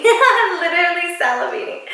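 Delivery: laughing through the words. Real, not acted. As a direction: giggle while saying it. Laughs about salivating.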